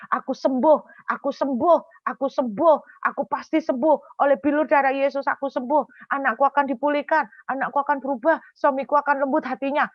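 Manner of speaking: high, loud, repeated proclaiming; insistent faith declaration. Retold or imitated. Believers keep declaring healing for family, high insistent proclamation. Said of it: "Kita terus deklarasi".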